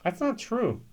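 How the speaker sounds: high, indignant that's not true, stress on not; flat denial. Real, not acted. Reviewer rebutting the distillery's label text, indignant and high. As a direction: push back hard on the claim.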